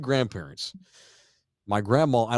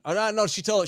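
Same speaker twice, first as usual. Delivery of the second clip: ordinary delivery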